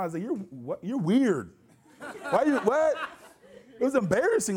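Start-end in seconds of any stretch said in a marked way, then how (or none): 0.2-3.0 stammered, incredulous retold reaction; acted bewilderment. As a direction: play baffled and weirded out, stumbling for words. Acting his bewildered reply to girlfriend.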